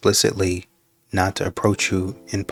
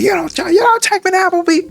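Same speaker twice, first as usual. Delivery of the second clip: much higher and louder, a hammed-up put-on woman's voice; mocking a picky woman; 6 dB louder than usual. Man mocking a woman who refuses his date idea, much higher and louder. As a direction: squeal the refusal in an exaggerated girly voice. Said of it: "when women talk like this".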